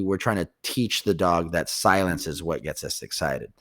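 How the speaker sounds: usual speaking voice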